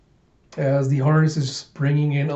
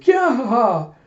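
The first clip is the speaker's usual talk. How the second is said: high, loud laughing cry; hammed-up burst of delight; villainous glee; 10 semitones higher, 5 dB louder than usual. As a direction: crow it like a gloating mad scientist.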